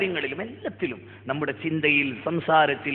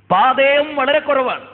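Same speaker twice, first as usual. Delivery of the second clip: higher and louder, slowed for weight; emphatic repeat; 8 semitones higher, 5 dB louder than usual. Retold or imitated. Preacher repeats the dying sahabi's words to the crowd, higher, louder and slow. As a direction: hammer the words home on the repeat.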